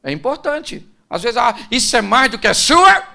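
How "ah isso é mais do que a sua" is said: sharp, loud mocking retort, pitched up; dismissive put-down. Scorning someone who finally improved, loud dismissive retort. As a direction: brush off their effort with scorn.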